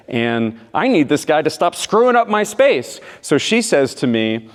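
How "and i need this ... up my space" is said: higher, louder annoyed rant; acted exasperation. Girlfriend sorts out her needs about him, acted exasperation. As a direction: let the irritation rise as you complain.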